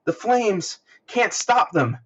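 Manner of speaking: high frantic cry; little boy yelling in terror at the flames; panicked screaming child. Retold or imitated. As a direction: shriek it like a burning kid. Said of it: "like, screaming".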